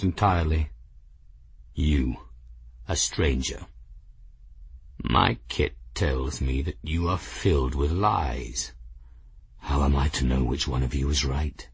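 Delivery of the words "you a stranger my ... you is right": low, soft growl of suspicion; quiet, deep-voiced old authority; growling clan chief. A narrator's reading. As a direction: question a stranger in a low growl. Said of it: "Norn growled finally".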